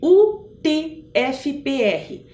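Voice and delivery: letter by letter, spaced out slowly; very slow spelling out. Shown as a demonstration. Guide spells out the university's acronym for listeners, very slowly. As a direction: say each letter slowly and separately. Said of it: "Eu vou falar bem devagarzinho".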